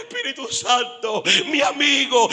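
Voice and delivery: loud, rising retold dialogue; wounded man and Holy Spirit, shouted; preacher's shout. Retelling dying man and Spirit.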